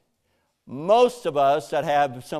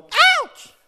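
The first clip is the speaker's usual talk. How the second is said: very high, loud squealing protest; talking tree in pain; 13 dB louder, slower than usual. Pretend. Fruit tree to the farmer pruning it, very high loud squeal. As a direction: shriek like a fruit tree being cut. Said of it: "And the tree's going".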